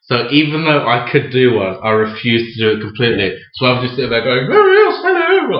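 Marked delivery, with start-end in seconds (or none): 4.5-5.6 put-on very high, louder falsetto girl voice; mock girl's voice. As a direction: send up a prissy girl, way up high. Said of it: "like, like that".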